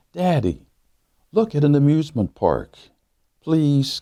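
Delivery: put-on high, eager child pleading with her daddy; a young girl begging to go to the park; little daughter's voice. A narrator's reading. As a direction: ask like an excited little girl.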